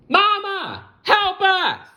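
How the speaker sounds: very high little-bug voice calling for mama; ladybug twins crying out. Acted. Stranded ladybug twins calling their mama in a high voice. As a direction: squeak it out like a tiny frightened ladybug.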